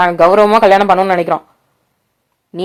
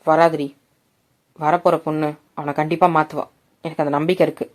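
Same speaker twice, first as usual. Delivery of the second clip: lower, softer fatherly voice; father's voice; 6 semitones lower, 7 dB quieter than usual. A narrator's reading. Father calming his anxious wife, low and soft. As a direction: reassure her gently as the father. Father reassures worried mother.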